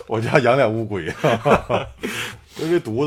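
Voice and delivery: talking through real laughter; laughing. Real, not acted. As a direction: laugh while you say it. Laughs at own turtle joke.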